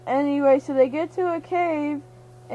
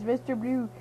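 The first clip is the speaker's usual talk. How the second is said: ordinary delivery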